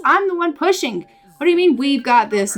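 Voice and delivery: acted exasperated objection, pitch jumping up; indignant. Mother in labor challenges her partner, indignant.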